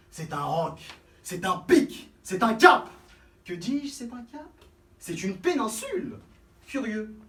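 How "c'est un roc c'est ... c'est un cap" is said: building description. Acted. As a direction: pile it up bigger each time.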